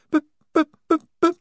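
high squeaky stammer, loud; a tiny voice stuck on one letter; stammering squeak. A narrator's reading. Wazza stammering to the squad, high and squeaky. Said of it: "she began".

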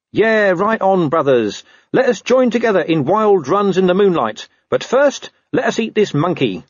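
high, hammed-up hippie wolf; cheesy pack-brother voice; mock wolf talk.

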